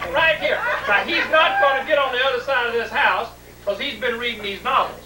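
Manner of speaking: ordinary delivery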